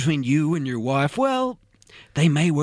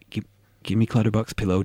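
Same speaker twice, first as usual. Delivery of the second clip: usual speaking voice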